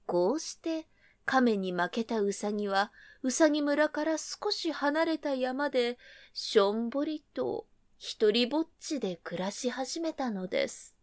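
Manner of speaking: plain reading voice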